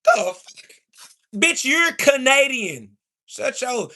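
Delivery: ordinary talk, nothing marked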